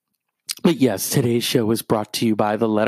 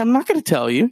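defiant retort; 10 semitones higher than usual. Retold or imitated. Own retort retold.

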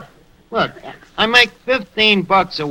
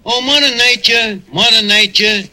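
hammed-up, louder poem reading; over-the-top recital to Mother Nature; 4 semitones higher, 4 dB louder than usual. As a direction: declaim it like a bad poet. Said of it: "A poem to nature by Anonymous".

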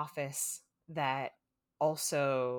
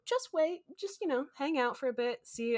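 imitating Mark; 9 semitones higher than usual. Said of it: "And he's like".

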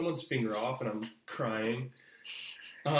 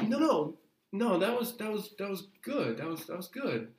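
awkward backpedal; 7 semitones higher than usual. Retold or imitated. Casting people caught laughing, awkwardly reassuring the actor. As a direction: hurry to cover the awkward moment. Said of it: "they got all awkward".

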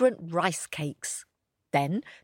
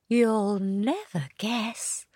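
whisper; 4 semitones higher, slower than usual. A narrator's reading. Rabbit whispers.

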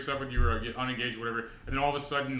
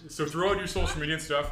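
redo intro; 5 semitones higher, 4 dB louder than usual. Pretend. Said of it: "we're starting at the top".

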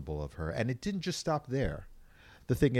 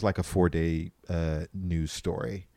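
ordinary talk

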